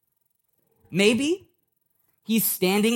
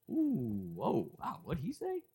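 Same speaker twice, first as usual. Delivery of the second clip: much softer, awkward murmur of shock; hushed crowd reaction; 13 dB quieter than usual. Acted. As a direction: play the uneasy onlookers, keeping it well down. Crowd shrinking from awkward remark.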